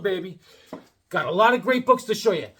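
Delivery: usual speaking voice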